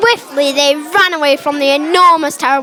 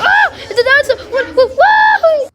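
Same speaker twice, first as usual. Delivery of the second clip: acted fright; 10 semitones higher than usual. Child spotting the dinosaur, laughing panicked shout. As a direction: shout the run with giggling fear.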